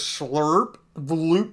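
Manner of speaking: slurp, bloop, a slow slurp then bloop; goo sound effect. Retold or imitated. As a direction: mimic the goo with your mouth. Imitating goo going down throat.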